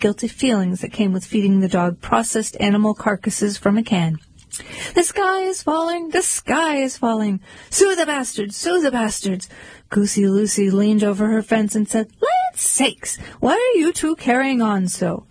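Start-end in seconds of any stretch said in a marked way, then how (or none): 4.9-9.5 high, frantic little chick crying alarm; high-pitched alarmed chicken voice; panicky Chicken Little. Acted. 12.2-15.2 put-on much higher, prim old-fashioned goose voice; high startled busybody goose; fussy goose. Goosey Lucy leans over fence.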